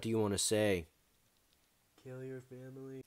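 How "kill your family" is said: distant radio voice. Acted. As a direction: speak as a talking radio. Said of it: "I have this cool ass radio and it can talk".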